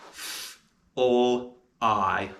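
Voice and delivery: f unvoiced, l, I said one by one; sounding out fly. Shown as a demonstration. Teacher sounding out fly for spellers. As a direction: break "fly" into f, l, I. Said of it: "And the sounds of fly are".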